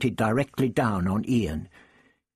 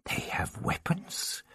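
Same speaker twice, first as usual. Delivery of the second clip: breathless hushed whisper; 2 semitones higher, 8 dB quieter, slower than usual. A narrator's reading. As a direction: whisper it in alarm. Hushed alarm under guns.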